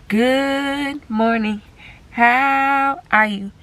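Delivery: slow, high, sing-song good morning; excited greeting. Real, not acted. Mother greeting viewers on discharge day, drawn-out excited hello. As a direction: stretch it out like a happy morning call.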